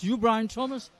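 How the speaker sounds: high, rising Yorkshire query; Geoffrey Boycott's Yorkshire accent. Retold or imitated. Checking a newcomer's name, imitated Yorkshire accent. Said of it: "in his nice Yorkshire accent".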